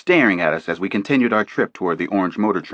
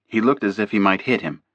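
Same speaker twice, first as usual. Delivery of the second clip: plain reading voice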